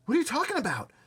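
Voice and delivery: put-on high, loud boyish demand; disbelieving question. A narrator's reading. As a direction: demand an explanation. Boy questions shocking news.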